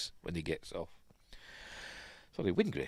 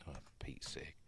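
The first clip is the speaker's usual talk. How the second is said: breathy whispered grumble under the breath; whispered exasperation; 6 semitones lower, 24 dB quieter than usual. Real, not acted. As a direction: whisper it, fed up. Muttered aside.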